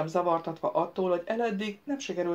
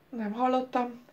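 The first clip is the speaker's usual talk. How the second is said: higher, sighing admission; sighed reply; 6 semitones higher than usual. A narrator's reading. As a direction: let it out with a sigh. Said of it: "sóhajtott Szajon". (sóhajtva)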